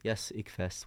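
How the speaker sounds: ordinary talk, nothing marked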